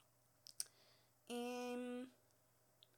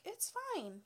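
gentle high reassurance; 9 semitones higher than usual. Retold or imitated. Sweet man reassuring her.

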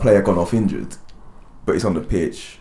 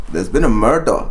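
Scottish accent; 2 semitones higher, 4 dB louder than usual. Shown as a demonstration. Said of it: "what your Scottish accent's like".

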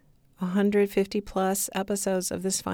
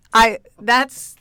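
normal talking voice

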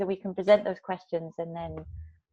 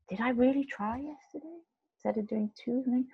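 soft, higher-pitched inner doubt; thinking to oneself; 5 semitones higher, 8 dB quieter than usual. Retold or imitated. Cyclist questioning yesterday's effort, soft self-doubt. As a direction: question yourself quietly, pitched up. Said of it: "I remember cycling home thinking".

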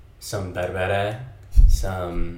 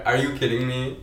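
ordinary talk, nothing marked

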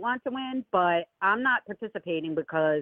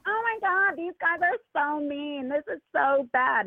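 hammed-up whining of offended campaign fans; mocking whiny critics; 8 semitones higher than usual. Imitating whiny political fans, high mock whine. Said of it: "Pure, pure bitch energy".

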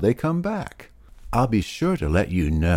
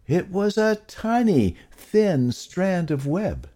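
tiny-sounding; 11 semitones higher than usual. A narrator's reading. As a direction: lift it high to make it tiny. Tiny strand against huge rope.

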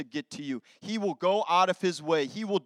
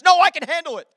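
proud refusal; 9 semitones higher, 10 dB louder, faster than usual. Retold or imitated. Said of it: "That's what I said to her".

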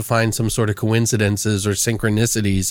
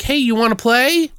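put-on high child ghost voice; 12 semitones higher than usual.

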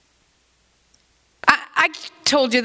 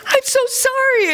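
high-pitched retold apology; exaggerated high "so sorry"; mock apology; 11 semitones higher than usual. Acting out apology to grandson.

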